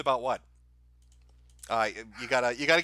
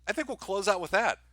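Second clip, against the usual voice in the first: ordinary talk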